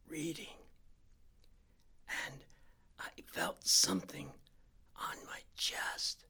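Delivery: slow, halting whisper, word by word; terrified whisper, pausing between phrases; slow careful whisper. A narrator's reading. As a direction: whisper each word without moving your stomach. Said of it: "he spoke very slowly".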